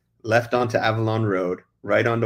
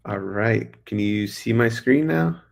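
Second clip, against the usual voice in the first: ordinary delivery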